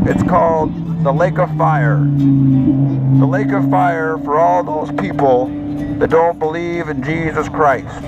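ordinary talk, nothing marked

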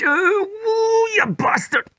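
yelping in pain as back twinges; pained cry. Acted. Pain trying to touch toes.

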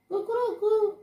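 high mock cock-a-doodle-doo; rooster crow. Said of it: "hen no dey do cuckoo".

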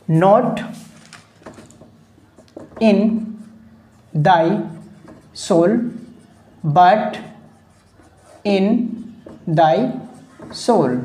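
word by word, long pauses between words; dictation pace. Shown as a demonstration. Teacher dictating a quoted line to students, word-by-word dictation. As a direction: dictate slowly for note-takers, one word at a time.

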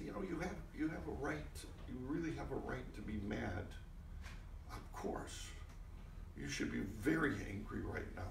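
soft, hushed reassurance; gentle note. Retold or imitated. Director coaching the actor before the yelling scene, soft gentle note. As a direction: quietly give the note.

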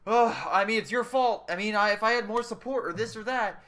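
lightly mocking complainer voice; whiny excuse. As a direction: play a sulky gamer blaming everyone. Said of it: "and it's like".

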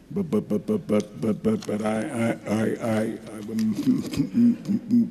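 put-on stammer, stuck on the first sound of words; mock stutter. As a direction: pretend to get badly stuck, repeating syllables over and over. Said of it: "I'm stuttering a little bit".

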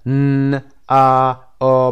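"ن", "ا", "آ" each held long, throat vibrating; voiced sounds. Shown as a demonstration. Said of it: "اینها با صدا".

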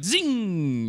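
high, drawn-out zoom noise; zing held long and high; zippy zing. Retold or imitated. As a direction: imitate a boost whizzing past. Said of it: "where it's like".

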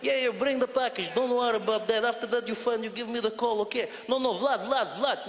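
put-on Russian-accented English. Russian arranging a package by phone, urgent thick accent. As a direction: hurry Vlad along in a thick Russian accent. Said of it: "the English Russian accent".

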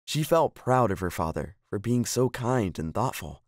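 ordinary delivery

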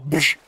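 loud mouth punch noise; punch sound effect. Retold or imitated. Imitated punch.

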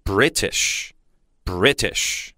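British said slowly and clearly twice; teacher's model word. Shown as a demonstration. Teacher to students, slow careful model. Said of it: "我们可以叫British".

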